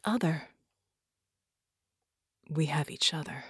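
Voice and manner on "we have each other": whisper. Acted. Tender inner thought.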